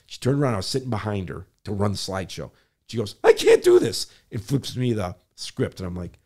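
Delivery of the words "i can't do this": high, loud, near-breaking plea; tearful imitation. Carol panicking in front of the council, high and loud tearful plea. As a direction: squeeze it out high and loud like she's about to cry. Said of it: "she started crying".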